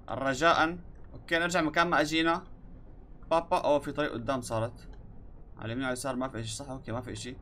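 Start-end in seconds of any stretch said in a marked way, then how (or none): none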